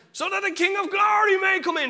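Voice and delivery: high, loud theatrical proclamation; Shakespearean actor. Retold or imitated. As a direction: boom it out to the back of the theatre. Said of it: "He's like a Shakespearean actor now".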